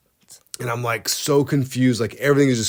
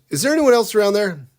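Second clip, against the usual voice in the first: higher, careful mother; concerned mom; 9 semitones higher than usual. Retold or imitated. Mom checking if anyone is with her son, high concerned voice. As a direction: ask gently but worried. Mom asks about help.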